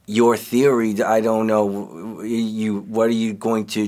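ordinary delivery

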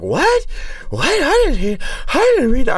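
high, confused crowd voice. Retold or imitated. Comic doing a high, baffled audience asking what he means.

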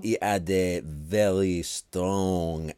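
loud, very slow French accent; drawn-out, hammed-up French accent; strong French accent. Shown as a demonstration. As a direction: labour through it in a thick French accent. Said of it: "do a French accent".

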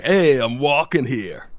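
loud, slow, high-pitched New York holler; badly faked New York shout; mock New York accent. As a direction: put on a hammy tipsy New Yorker. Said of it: "do you go full New York then".

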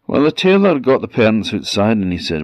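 ordinary delivery, nothing marked

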